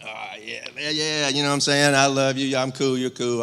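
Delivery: mocking how guys brush off affection; macho guy talk. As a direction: play the back-slapping buddy. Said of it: "whenever you're a guy".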